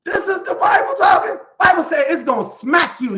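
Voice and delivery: high, loud preaching shout; preacher shouting the scripture at the congregation. Real, not acted. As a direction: lift it to the rafters.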